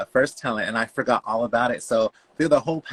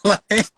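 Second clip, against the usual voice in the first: squealing joyful laugh; high delighted laugh; 10 semitones higher, 5 dB louder than usual. Real, not acted. Recalling the jackpot win, delighted high laugh. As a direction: laugh with glee.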